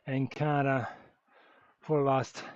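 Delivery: ordinary talk, nothing marked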